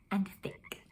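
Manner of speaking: breathy whisper, no voice. A narrator's reading. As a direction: drop to a hush on the last words. Narrator hushes the frog image.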